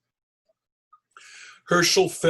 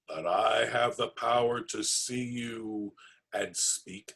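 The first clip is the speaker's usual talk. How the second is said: lower, softer, menacing goblin king; slow measured threat from far away; distant goblin king; 5 semitones lower, 3 dB quieter, slower than usual. Acted. Goblin king speaking from afar.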